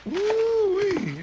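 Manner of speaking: astonished whoop. Acted. Shocked at wrecked bank.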